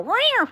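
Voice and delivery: loud, high imitated cat growl "Rawr"; cat yowl. Owner imitating her cats warning each other, loud high mock yowl. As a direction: belt out a playful mock cat hiss-growl. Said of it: "you know, really loudly".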